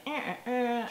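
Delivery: a light mock whine like a fussy child; whiny child whimper. Parent imitating a cranky child, mild mock whimper. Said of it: "a bit whiny".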